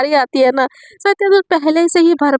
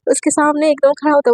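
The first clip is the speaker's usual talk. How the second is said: ordinary delivery, nothing marked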